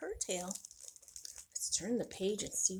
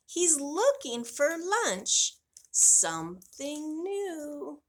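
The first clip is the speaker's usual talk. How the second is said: slow dramatic reading; 4 semitones higher, 6 dB louder, slower than usual. Acted. Dramatic storytelling of cat.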